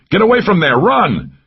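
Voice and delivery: high, loud warning yell; urgent shout. A narrator's reading. Mac yelling at Laura to run from gunfire, loud. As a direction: scream at her to flee. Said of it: "I shouted".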